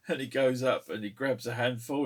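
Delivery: usual speaking voice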